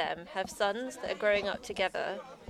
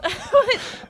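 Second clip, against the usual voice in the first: burst of laughter; 14 semitones higher, 10 dB louder than usual. Real, not acted.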